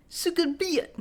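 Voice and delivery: a jokey Russian accent as if something is stuck in the teeth; mock Russian. Shown as a demonstration. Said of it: "talk like there's something stuck in your teeth".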